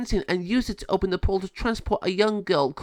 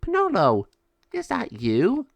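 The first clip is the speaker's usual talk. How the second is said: higher, astonished call; surprised; 10 semitones higher, 4 dB louder than usual. Acted. Lama Sabio recognising Penelo, surprised. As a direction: lift the voice in delighted disbelief.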